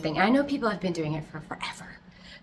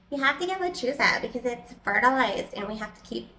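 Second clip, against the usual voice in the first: higher-pitched mock mom voice; imitating mom; 5 semitones higher than usual. Daughter imitating mom insisting on lechuza, higher voice. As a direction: quote your mother in a raised pitch. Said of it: "she's very much like".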